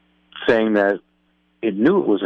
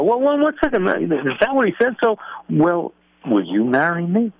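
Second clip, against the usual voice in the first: princess voice; 10 semitones higher than usual. Acted. Princess asks the traveler.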